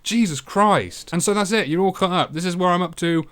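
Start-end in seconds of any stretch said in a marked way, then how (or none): 0.0-1.1 disgusted. Real, not acted. Disgusted by the monsters.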